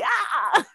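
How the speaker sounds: high mock yell of an angry mother; mom's yell imitated. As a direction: shriek like mom with the sandal. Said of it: "pero ella, así".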